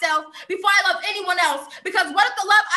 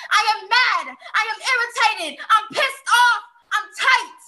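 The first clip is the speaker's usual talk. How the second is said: higher-pitched, mounting exasperation; mad, irritated; 4 semitones higher than usual. Acted. Poet summing up why she is mad, angrily emphatic. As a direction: punch out each short declaration of anger. Poem's climax of listed anger.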